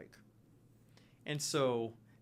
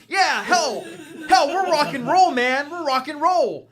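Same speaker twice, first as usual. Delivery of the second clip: higher, louder wild-eyed cheer; defiant rocker; 11 semitones higher, 12 dB louder than usual. Acted. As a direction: throw up the horns in denial. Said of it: "He goes".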